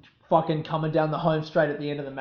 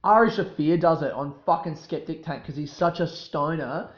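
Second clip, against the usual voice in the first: ordinary talk, nothing marked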